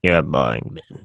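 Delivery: low growling demon voice. Pretend. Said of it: "my inner demon voice".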